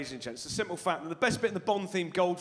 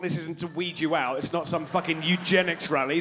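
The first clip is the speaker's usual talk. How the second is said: ordinary talk, nothing marked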